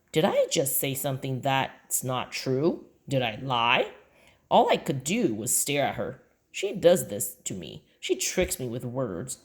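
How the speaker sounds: usual speaking voice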